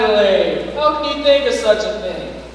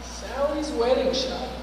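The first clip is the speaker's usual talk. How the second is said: quiet; 2 semitones higher, 10 dB quieter than usual. Acted. Hushed answer.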